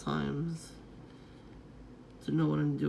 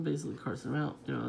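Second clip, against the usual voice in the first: usual speaking voice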